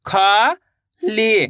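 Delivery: खा and ली held long, a pause between; sounding out syllables. Shown as a demonstration. Teacher shows how it is read, खा and ली sounded out separately. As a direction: stretch खा, pause, then stretch ली. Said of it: "एक बार देखिए किस तरीके से इनको पढ़ा जाता है".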